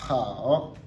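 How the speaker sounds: "hao" low dip, then rise; "hao" as a slow falling-rising syllable; unchanged third tone. Shown as a demonstration. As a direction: sink low on "hao" and curve back up. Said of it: "le troisième reste tel".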